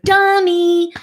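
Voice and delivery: mock insult.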